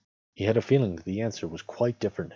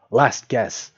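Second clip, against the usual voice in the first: loud, raised announcement; firm warning; 5 semitones higher, 7 dB louder than usual. A narrator's reading. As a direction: call it out as a final warning. Bilbo warns Gollum.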